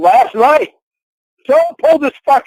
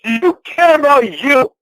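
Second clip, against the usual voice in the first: high, demanding yell; angry yelling; 6 semitones higher than usual. Real, not acted. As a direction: shout the questions at her. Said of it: "Why are you yelling, though?".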